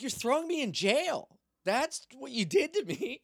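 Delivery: high, loud exasperated answer; mock indignant retort. Prisoner answering the cop jailing him, mock indignant retort. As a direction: shoot it back at high pitch, outraged.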